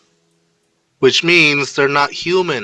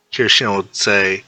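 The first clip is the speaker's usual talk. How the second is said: plain reading voice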